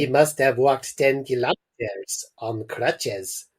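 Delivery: put-on a light French doctor accent; lightly put-on French accent. French doctor to the parents, light French accent. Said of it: "this is a French accent".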